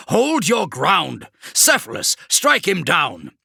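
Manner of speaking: slow, high, loud command; rallying shout. Acted. Kravrek ordering his men and sorcerer, loud slow shout. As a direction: hold them steady by force of voice.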